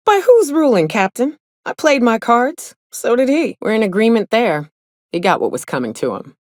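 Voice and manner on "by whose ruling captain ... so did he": laughing and teasing, pitched up. Real, not acted. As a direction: laugh it out, challenging the captain.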